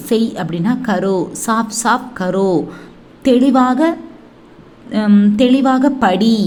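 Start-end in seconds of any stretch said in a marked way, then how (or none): none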